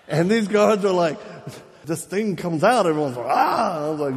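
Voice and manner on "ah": high imitated scream; mock group scream. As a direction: scream like a startled crowd, hammed up. Everyone screams at the thing.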